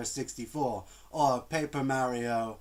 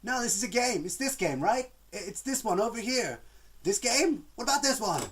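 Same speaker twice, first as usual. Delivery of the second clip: high, pushy, insistent voice; mocking YouTube; 9 semitones higher, 3 dB louder than usual. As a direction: play YouTube pushing its guesses. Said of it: "YouTube is saying".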